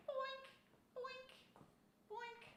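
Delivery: high, soft cartoon boink sound; playful boink. Showing off her outfit to viewers, high soft cartoon boinks. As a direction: pop each boink out high and light.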